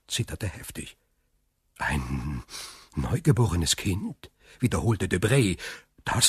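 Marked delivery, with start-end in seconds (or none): 1.8-4.3 low, soft, halting question; stunned echo. Acted. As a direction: repeat it haltingly, as if taking it in. Guest echoes the shocking news. (betroffen, leise und zögernd)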